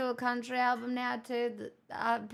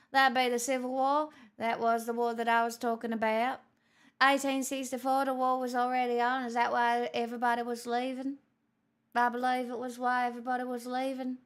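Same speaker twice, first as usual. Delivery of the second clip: lightly put-on wandering posh British accent, quick; a hint of posh British with drifting accent; mock mixed accent; faster than usual. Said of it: "do you like my mix of accents".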